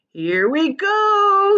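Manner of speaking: high, loud, slow stretched cry; excited shout. A narrator's reading. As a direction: hop with excitement as you yell. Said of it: "shouted the old green grasshopper".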